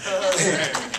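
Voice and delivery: short sheepish laugh; embarrassed laugh. Real, not acted. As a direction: laugh off the slip. Embarrassed after a mistake.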